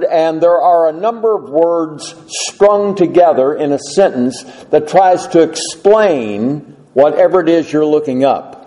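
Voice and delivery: ordinary talk, nothing marked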